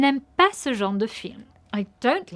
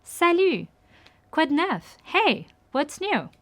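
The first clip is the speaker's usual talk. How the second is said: cheerful, higher hello to a friend; bright greeting; 6 semitones higher than usual. Shown as a demonstration. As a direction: greet a friend you're glad to see. Friendly greeting in example dialogue.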